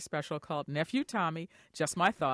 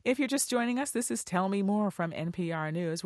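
ordinary delivery

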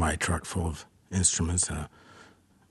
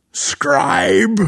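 high, loud retold holler; yelling across room; 12 semitones higher, 8 dB louder than usual. Bandmate summoning his note-taker, imitated yell. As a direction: holler it like calling someone far off. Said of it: "he would yell across the room".

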